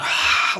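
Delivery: breathy imitated crowd roar. Comic imitating a crowd going nuts, breathy roar. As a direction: mimic a cheering crowd on one voiceless breath. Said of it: "the crowd was like".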